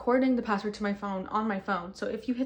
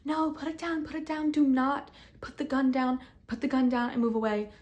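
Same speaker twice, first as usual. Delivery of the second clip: much higher, pleading scream; retold screaming; 5 semitones higher than usual. Screaming at her not to shoot herself, pleading. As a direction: beg her urgently to put it down. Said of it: "I was screaming".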